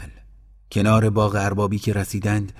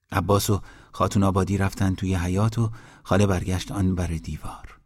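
ordinary delivery

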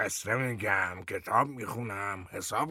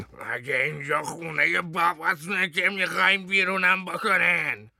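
much higher, louder furious yell; shouting; 9 semitones higher, 6 dB louder than usual. Acted. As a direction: scream it while clinging to the chair.

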